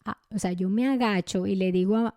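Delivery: ordinary talk, nothing marked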